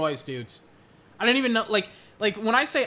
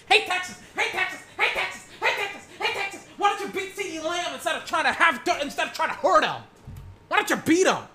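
much higher taunting call-out; heckling call; 10 semitones higher than usual. Pretend. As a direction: taunt the defenders across the field. Heckling Texas defenders on screen.

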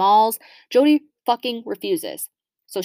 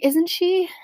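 high-pitched cooing agreement, re-enacted; acted gushing; 9 semitones higher than usual. Daughter agreeing with dad about the puppy, acted high gushing. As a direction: coo back in a much higher voice.

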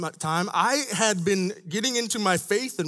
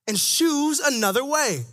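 higher, rising preacher's fervor; preaching build; 6 semitones higher than usual. Acted. Preacher proclaiming Jesus' teaching with rising fervor. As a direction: lift it up like a call.

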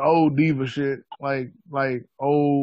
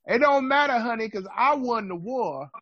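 booming wrestler boasts; 8 semitones higher than usual. Retold or imitated. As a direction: cut a diva wrestling promo. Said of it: "[name] cutting a promo on [name]".

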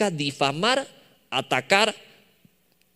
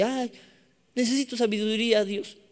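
higher, hurried little plea; meek plea to God; 5 semitones higher than usual. Acted. Believer asking God for wisdom, higher mock plea. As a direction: voice a timid person asking God. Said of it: "Es así de".

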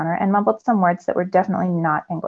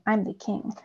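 almost silent, just mouthing; mouthed; 9 dB quieter than usual. A narrator's reading. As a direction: barely voice the words.

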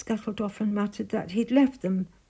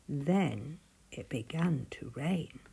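ordinary delivery

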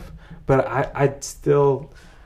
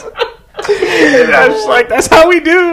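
high, loud laughing joke; laughing that's how we do; 10 dB louder than usual. Pretend. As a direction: laugh it out, mock proud. Joking back in Cantonese accent.

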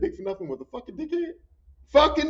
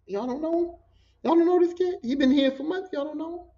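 high-pitched, baffled repeated questions; incredulous questioning; 7 semitones higher than usual. Retold or imitated. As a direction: press them in rising disbelief.

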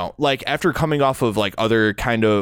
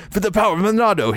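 mock game hero line; 10 semitones higher than usual. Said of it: "as bad as Xenoblade Chronicles voice acting".

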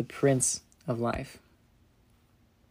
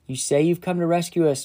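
raised, louder jeering voice; shouted taunt; 4 semitones higher, 6 dB louder than usual. Acted. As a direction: shout it up at the cross in mockery. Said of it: "people shouted".

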